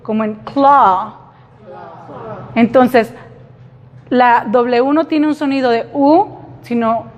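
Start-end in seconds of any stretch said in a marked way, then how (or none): 0.4-1.1 claw drawn out slowly with an open A; careful claw. Shown as a demonstration. Teacher showing the AW sound, slow demo. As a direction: stretch the vowel in claw. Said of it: "escuchamos claramente la A y la W".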